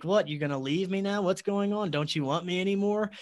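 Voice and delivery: lightly acted taunt from a pursuer; retold taunting. Entity chasing the fleeing man, retold taunting.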